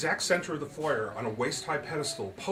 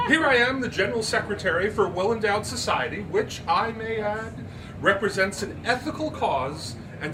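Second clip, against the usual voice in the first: haughty society lady; 7 semitones higher, 6 dB louder than usual. Acted. Miss Turpin's haughty voice.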